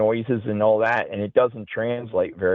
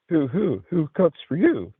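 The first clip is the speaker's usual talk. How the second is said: barred owl call imitation; 6 semitones higher than usual. Telling friends about the night, imitating a barred owl's hooting call. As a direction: hoot the owl's question, pitched high. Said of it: "it starts out as a barred owl".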